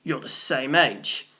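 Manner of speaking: slow, low grumble; sulky boy grumbling under his breath; grumbled. A narrator's reading. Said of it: "he grumbled".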